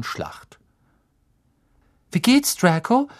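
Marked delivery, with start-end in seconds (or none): 2.1-3.1 put-on high, louder girl's voice; admiring schoolgirl. A narrator's reading. Pansy asks wounded Draco how he is, admiring, high-pitched. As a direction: coo admiringly, pitched well up.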